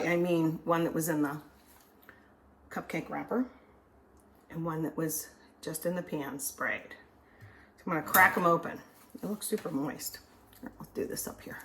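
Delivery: ordinary talk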